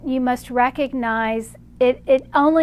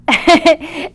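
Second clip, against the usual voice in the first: real amused laugh, loud and high; sudden amused cackle; burst of laughter; 4 semitones higher, 8 dB louder than usual. Real, not acted. Amused by the answer given.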